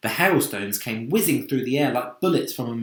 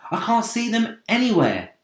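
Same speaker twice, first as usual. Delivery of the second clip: put-on raised boyish call; high calling voice; 12 semitones higher, 5 dB louder than usual. James reporting from the top of the peach, raised call. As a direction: call out, reassured.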